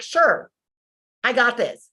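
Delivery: high, overconfident shrug of a reply; cocky confidence. Retold or imitated. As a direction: toss it off like nothing can stop you. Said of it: "I was like".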